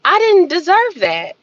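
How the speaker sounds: ordinary talk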